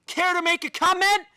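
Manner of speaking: loud, high taunting demand; mocking challenge. Real, not acted. Taunting the silent company on stream, loud and high. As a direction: call them out loudly.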